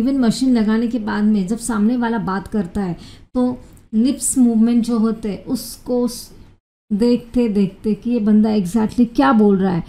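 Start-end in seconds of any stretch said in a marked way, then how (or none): none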